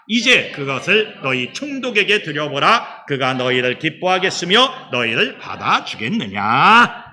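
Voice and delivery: steady unison reading aloud; measured congregational scripture reading. Acted. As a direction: read the verse together with the church.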